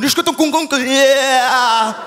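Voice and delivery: drawn-out mock-Cantonese wail, as if losing balance. Comedian showing how Cantonese sounds, mock Cantonese wail. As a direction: start a Cantonese sentence and topple into a long vowel. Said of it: "on dirait qu'ils débutent une phrase, mais ils perdent l'équilibre".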